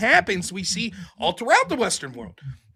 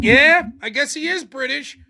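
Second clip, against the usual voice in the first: higher, slow mock concession; grudging agreement; 8 semitones higher than usual. Mocking a listener who gives in, slow grudging agreement. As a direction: concede it slowly and reluctantly.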